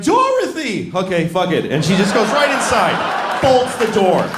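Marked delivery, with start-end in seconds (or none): 0.0-0.9 high call of Dorothy. Acted. Aunt calling for Dorothy, high call. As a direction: call her name across the farm. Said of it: "Auntie Anne's like".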